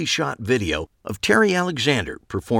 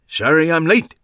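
Max's voice; 9 semitones higher, 6 dB louder than usual. A narrator's reading. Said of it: "Max continued".

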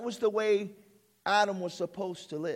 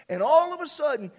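higher and louder, startling; sudden loud burst; 8 semitones higher, 8 dB louder than usual. Real, not acted. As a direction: jump up in pitch and volume. Sudden interruption in sermon.

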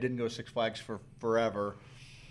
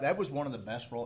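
normal talking voice

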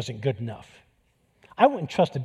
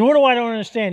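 loud, higher blunt demand; direct challenge; 7 semitones higher, 6 dB louder, faster than usual. Retold or imitated. Friend bluntly pressing the death row inmate, loud direct question. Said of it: "he's real direct".

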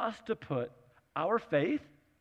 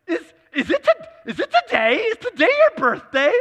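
much higher, louder, half-crying panic; flustered high disbelief; 14 semitones higher, 11 dB louder than usual. Retold or imitated. Mom in the car suddenly realizing, panicked high disbelief. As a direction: blurt it out as the stunned mother.

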